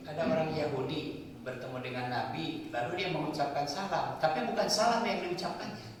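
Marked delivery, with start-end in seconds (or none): none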